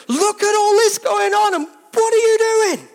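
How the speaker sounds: high, loud, stretched-out accusing yell; frantic shout at a sleeping God; desperate shout. Acted. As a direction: cry out at God in a panic. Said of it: "shout it like that".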